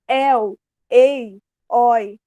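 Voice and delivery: "el, ei, oi" said loudly with open vowels, one by one; "el, ei, oi" as emphatic, open-vowel examples; open diphthongs. Shown as a demonstration. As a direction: punch each open diphthong separately: "el", "ei", "oi". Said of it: "que é ditongo aberto".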